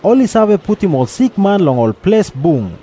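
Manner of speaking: ordinary delivery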